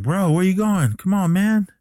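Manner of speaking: high, pleading call at moderate volume; retold calling after. Friend calling after departing biker, mock protest. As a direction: imitate calling after someone leaving.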